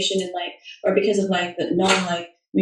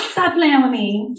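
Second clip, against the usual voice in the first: drawn out, higher, with a rising end on "Stop playing with me"; playful scolding; 4 semitones higher than usual. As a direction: tease them: stretch out the words slowly and lift the end. Second, drawn-out playful take.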